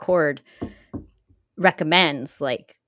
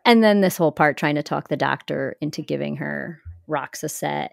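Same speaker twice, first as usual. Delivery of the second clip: usual speaking voice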